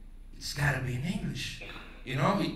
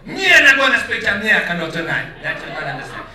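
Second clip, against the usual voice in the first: mock Jamaican; 4 semitones higher, 13 dB louder than usual. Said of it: "maybe it's like Jamaican".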